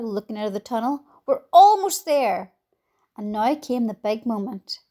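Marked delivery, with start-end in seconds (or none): 1.2-2.5 loud, slow, higher-pitched call; shouting. A narrator's reading. Grasshopper urging on the nearly lifted crew, shouting. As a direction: call out encouragement, stretching it. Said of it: "shouted the old green grasshopper".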